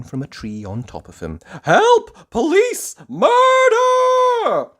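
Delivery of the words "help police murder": very high, loud screaming; terrified scream. Acted. Mister Jelly covered by a leaf, screaming for help. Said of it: "he screamed".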